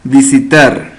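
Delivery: ordinary talk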